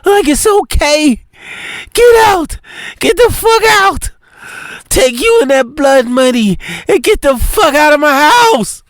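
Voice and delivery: high, shouted rage; furious. Acted. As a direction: throw him out, screaming. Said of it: "her tears turns into anger".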